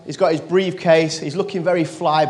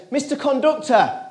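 higher-pitched mock call; 7 semitones higher than usual. Boy to conductor walking away, calling out. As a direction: call for attention in a raised pitch, without shouting.

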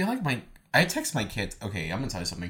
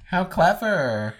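sarcastic; 8 semitones higher, 5 dB louder, much slower than usual. Real, not acted. Mock praise of pun.

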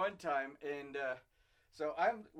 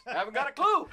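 laughing; 6 semitones higher, 6 dB louder than usual. Retold or imitated. Caught out by coach, laughing.

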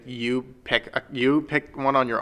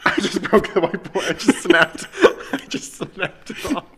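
laughing; 8 semitones higher, 4 dB louder than usual. Real, not acted. Breaks whiteboard, laughs.

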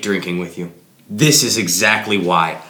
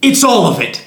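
high, raised exasperated voice; 9 semitones higher, 5 dB louder than usual. Acted. Answering his friend's endless question, exasperated. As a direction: spell it out loudly, out of patience.

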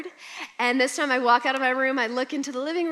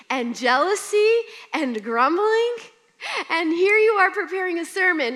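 rising emotion; 7 semitones higher, 7 dB louder than usual. Real, not acted. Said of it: "emotional self-rebuke".

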